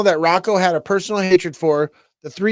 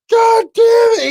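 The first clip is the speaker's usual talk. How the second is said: slow, high, loud shout; furious shout; 8 dB louder, slower than usual. Retold or imitated. Retelling the gunman losing his mind, a high slow shout. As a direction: explode with rage, slowly. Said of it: "He's like".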